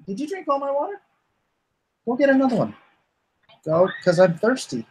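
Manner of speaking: a bossy character demanding water; lightly hammed-up nagging voice; put-on voice. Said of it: "doing all these voices".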